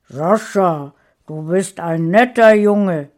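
lauter Baum mit fremder Stimme; sprechender Baum. A narrator's reading. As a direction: wie ein alter Baumgeist. Der Baum spricht. (laute Baumgeisterstimme)

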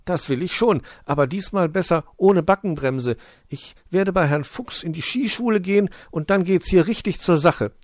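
high chirpy little bird. Acted. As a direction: chirp a greeting. Bird boasts about ski school.

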